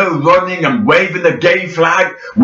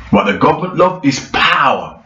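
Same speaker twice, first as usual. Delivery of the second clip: ordinary delivery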